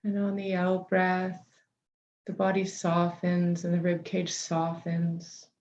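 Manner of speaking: unhurried meditation guide, long pauses between phrases; guided meditation. Real, not acted. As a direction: settle listeners gently into the body, leaving space for each breath.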